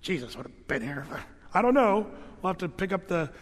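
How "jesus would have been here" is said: low, soft mean grumble; meaner version. Acted. Sisters talking behind Jesus' back, meaner muttered grumble. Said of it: "said it meaner when he wasn't".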